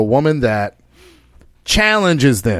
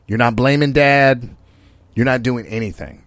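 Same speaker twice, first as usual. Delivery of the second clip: usual speaking voice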